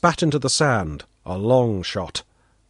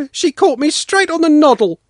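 put-on high, loud aggrieved boy; boy complains from behind the cart, angry; 14 semitones higher, 6 dB louder than usual. A narrator's reading. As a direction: snap out the grievance. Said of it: "Sanka said angrily".

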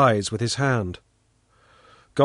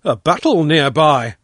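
loud echo, only slightly raised in pitch; echoed exclamation; 3 semitones higher, 6 dB louder than usual. A narrator's reading. Father echoing Pashka's news, loud exclamation. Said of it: "I echoed".

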